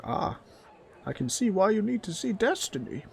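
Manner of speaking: high-pitched goblin voice; reply as a high, unimpressed goblin; unamused goblin. Acted. Goblin replies to customer.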